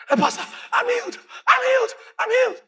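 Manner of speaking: high, tearful cry of joy; teary joy. Retold or imitated. Woman telling pastor she's healed, high tearful. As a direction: cry it out overwhelmed.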